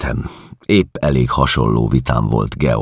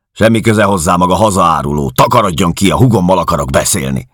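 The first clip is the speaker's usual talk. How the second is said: loud, higher angry command; furious order; 6 semitones higher, 6 dB louder, faster than usual. Acted. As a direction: throw him out with your voice. Georg ordering narrator out.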